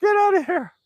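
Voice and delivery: high, squawky crow voice; imitation bird shrieking a warning; screaming crow. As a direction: screech the words like a crow.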